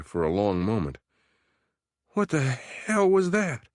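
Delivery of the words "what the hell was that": high, slow, shaken question; a frightened man barely holding steady; trembling voice. Acted. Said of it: "Yank asked in a trembling voice".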